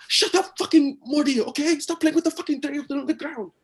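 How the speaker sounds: high, loud angry outburst; exasperated parent shouting. Acted. Hiding parent silencing a chattering toddler, furious high shout. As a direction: snap at the kid at full volume.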